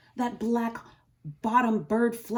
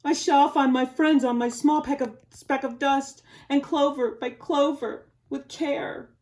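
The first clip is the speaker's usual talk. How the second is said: louder resolute elephant voice; determined Horton; 5 semitones higher, 8 dB louder than usual. Acted. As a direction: steadfast and stubborn. Horton vows to search.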